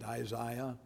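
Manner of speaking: Isaiah the English way, middle syllable like "zy"; English pronunciation. Shown as a demonstration. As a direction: say Isaiah as eye-ZY-uh, English style. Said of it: "That's how they say it".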